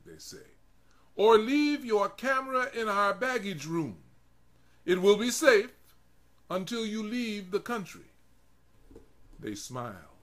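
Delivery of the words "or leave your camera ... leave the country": much higher, louder official pronouncement; stern officials. Acted. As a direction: lay down the law to him. Officers offering fake alternative.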